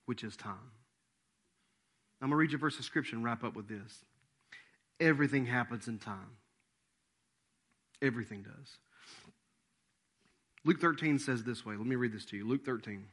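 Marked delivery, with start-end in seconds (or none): none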